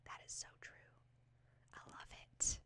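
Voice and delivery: very soft, whispered aside; awed whisper. Real, not acted. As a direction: whisper it to yourself in admiration. Awed aside after reading the quote.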